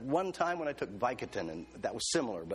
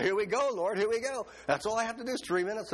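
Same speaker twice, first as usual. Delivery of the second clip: high, eager start; excited; 7 semitones higher than usual. Retold or imitated. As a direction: psych yourself up, pitch raised. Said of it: "so I get all excited".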